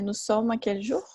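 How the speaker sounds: "Nous sommes à quel jour?" with the voice rising on "jour"; rising question tune on "jour"; question by intonation. Shown as a demonstration. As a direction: make "jour" rise to turn the statement into a question. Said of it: "só com a entonação".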